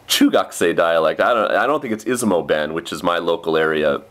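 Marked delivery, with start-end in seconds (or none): none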